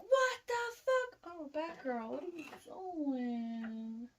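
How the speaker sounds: high mock-shocked stepdad yelp, drawn out. Stepdad catching a man in her room, shocked and high-pitched.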